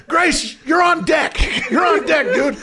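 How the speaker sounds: high shouted call to the batter; urgent call from the tunnel. Retold or imitated. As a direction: yell it down the tunnel like a bat boy. Bat boy calling him out.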